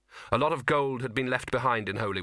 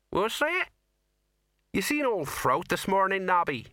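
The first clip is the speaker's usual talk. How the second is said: high, sly little soldier; a weaselly corporal piping up; Nobby's voice; 9 semitones higher than usual. Acted. Nobby asks his sergeant, high and sly.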